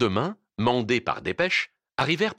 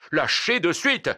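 raised, furious command; angry shouted order; enraged yell; 7 semitones higher, 4 dB louder, slower than usual. Acted. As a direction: order him off in a rage. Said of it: "hurla le brigadier avec rage".

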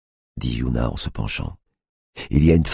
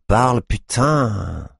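higher, slow, drawn-out impatient order; annoyed snap; 9 semitones higher, much slower than usual. A narrator's reading. Tiffany cutting off the cop, slow and irritated. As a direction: drag it out, fed up. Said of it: "en faisant un geste agacé".

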